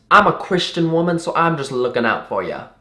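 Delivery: pious Southern lady impression; put-on Southern sweetness, high then easing; Southern accent. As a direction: imitate a well-meaning Southern woman. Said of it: "really bubbly and talkative and Southern".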